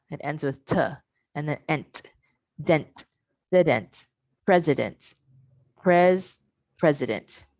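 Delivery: "T", "ent", "dent", "president", then "pres" stressed; back-chain drill. Shown as a demonstration. Teacher repeats the back chain, showing the T sound. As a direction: build it from the end and stress PRES. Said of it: "but the sound is T".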